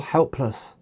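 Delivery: ordinary delivery, nothing marked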